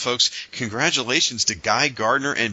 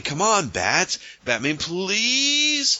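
much higher whiny begging; pleading sidekick voice; 9 semitones higher, much slower than usual. Retold or imitated. Guy Gardner pestering Batman, high whiny mock plea. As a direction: beg like a clingy pest. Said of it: "someone off panel is talking to Batman, saying".

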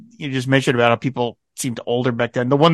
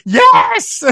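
high, loud, indignant mock retort; mock insulted; 13 semitones higher, 5 dB louder than usual. Nurse answering the colonel's delicate question, insulted. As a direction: act hugely affronted, pitch shooting up. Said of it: "And she's so insulted".

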